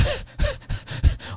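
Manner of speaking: silent, wheezing high laugh; breathless laugh. Real, not acted. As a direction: laugh without voice, caught off guard. Embarrassed by compliment on wife.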